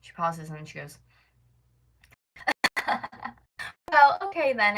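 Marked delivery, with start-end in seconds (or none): 2.3-3.3 mock burst of laughter; imitated laughing. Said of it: "Like, she laughed, guys, like, laughed". 3.9-4.8 loud, high put-on brush-off; mock dismissive lady. Imitates lady right after laughing.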